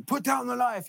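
high-pitched mock police command; retold police yell. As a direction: imitate cops yelling an order. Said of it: "they're yelling at him".

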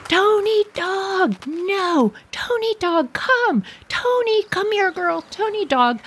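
high, desperate calling for the dog; panicked owner calling her dog back; frantic calling. Retold or imitated. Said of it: "I was crazy screaming".

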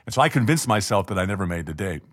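normal talking voice

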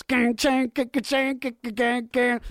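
mouth guitar riff. Acted.

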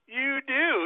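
high, startled "You do?"; surprised officer. Retold or imitated. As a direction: sound caught off guard by the news. DNR officer voiced, surprised.

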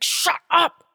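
high, forced whisper-shout, exasperated; furious church whisper. Retold or imitated. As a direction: scold him in a strained stage whisper. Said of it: "And I'm like".

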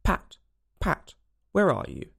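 slow, mildly angry call; a touch of anger, drawn out. A narrator's reading. Rabbit hunting for Pat, mildly angry call. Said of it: "Next came an angry voice".

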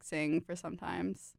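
ordinary talk, nothing marked

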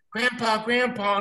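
a light imitation of excited grandkids; kids calling. Grandkids greet grandpa boarding the plane, lightly imitated.

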